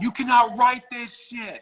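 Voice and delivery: high-pitched mock yell, only mildly loud; drawn-out, high exclamation imitating a scream; retold scream. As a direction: re-enact yelling at the screen in disbelief. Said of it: "I screamed out loud".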